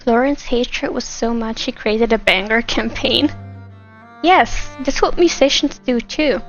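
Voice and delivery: ordinary talk, nothing marked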